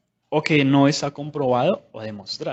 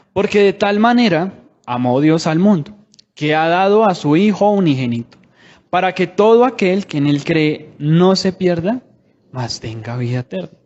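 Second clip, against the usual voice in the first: raised, louder solemn Bible reading that fades on the last words; scripture reading; 3 semitones higher, 4 dB louder than usual. Acted. Preacher reading John 3:16 to the congregation, solemn proclaiming read.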